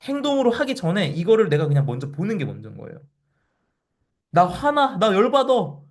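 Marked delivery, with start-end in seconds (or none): 4.3-5.8 higher, hammed-up fuming; half-playful mock anger; mock seething. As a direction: pretend to boil over. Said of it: "부글부글".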